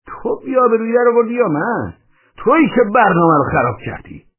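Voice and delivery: outraged, squawking-high counter-accusation; indignant comeback. A narrator's reading. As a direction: throw the blame straight back. Man throws accusation back.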